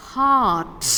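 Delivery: "Heart" said as haat, long ah, R dropped, firm and loud; heart without R. Shown as a demonstration. Teacher modelling the word for the class: "Heart" as haat, loud. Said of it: "is pronounced as heart".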